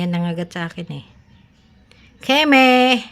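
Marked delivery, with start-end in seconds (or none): 2.2-3.0 high, loud sharp call; loud name call. Real, not acted. Owner calling Keme, loud high shout. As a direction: call out the pet's name loudly.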